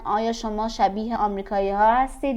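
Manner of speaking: ordinary talk, nothing marked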